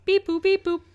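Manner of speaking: high robot thinking sounds; robot noises. Pretend. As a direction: beep like a thinking computer.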